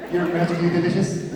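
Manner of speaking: put-on playful Irish-accent catchphrase; mock Irish accent. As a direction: ham up a cartoonish Irish accent. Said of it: "That's Ireland".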